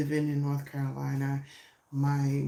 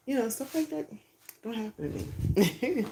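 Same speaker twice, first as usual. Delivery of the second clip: excited; 8 semitones higher than usual. Real, not acted. Overjoyed about good news.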